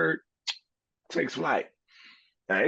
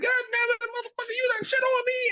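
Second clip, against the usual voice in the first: high squeaky cartoon bird voice cursing; squeaky little bird. Little bird cursing at the cow in a squeaky high voice. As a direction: squeal it out like a tiny furious bird. Bird in story gets pooped on.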